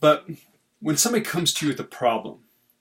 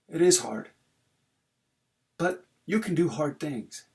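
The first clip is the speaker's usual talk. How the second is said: ordinary talk, nothing marked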